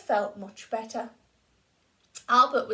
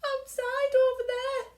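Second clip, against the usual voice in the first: sobbing; 13 semitones higher than usual. A narrator's reading. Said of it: "sobbed his tree".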